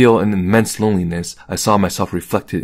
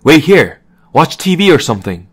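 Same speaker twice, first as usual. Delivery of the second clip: high, loud call over the shoulder; raised, hurried parting shout; calling back; 7 semitones higher, 7 dB louder than usual. A narrator's reading. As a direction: call out in a hurry to someone left behind. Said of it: "Yuchi called back to me".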